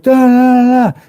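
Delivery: high stuttered "da-da-da" noise; mock panicked babble. People in the warbly glass, babbling in panic. Imitates people inside the view.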